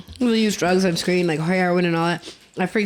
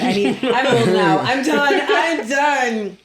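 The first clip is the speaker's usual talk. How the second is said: laughing; 5 semitones higher, 3 dB louder, slower than usual. Retold or imitated. Retold mother reacting with laughter.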